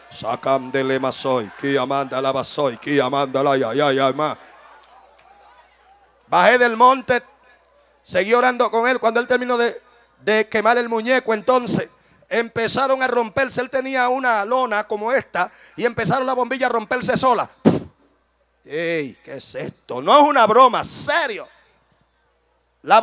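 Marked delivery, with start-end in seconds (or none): 0.1-4.4 low, level chant-like prayer; steady monotone prayer in tongues; praying in tongues. Real, not acted. Praying in tongues mid-sermon. 18.6-20.0 low, soft acted disbelief; quiet, deep reenactment of puzzlement; hushed bewilderment. Acting bewilderment at bulbs breaking.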